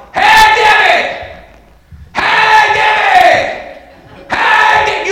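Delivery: very high, loud, drawn-out calling; hollering for someone. Acted. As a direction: holler across a huge store for your wife. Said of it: "I go down through there hollering".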